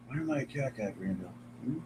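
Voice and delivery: very soft murmured question to the cat. Real, not acted. Streamer murmurs the question to his cat, softly.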